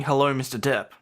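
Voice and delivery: normal talking voice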